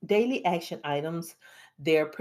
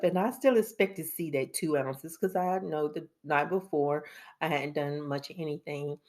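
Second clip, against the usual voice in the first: ordinary talk, nothing marked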